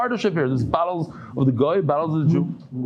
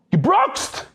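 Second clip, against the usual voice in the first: high retold scream of one word; 11 semitones higher than usual. Husband screaming at his wife over gebroxt, high shouted retelling. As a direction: yell it up high as if horrified. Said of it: "He screams at her".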